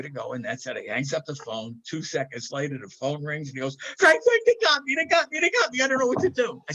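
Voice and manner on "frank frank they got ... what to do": much higher and louder, frantic retold panic; panicked call. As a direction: blurt it out like a man who thinks he's caught. Panicked friend calls after police.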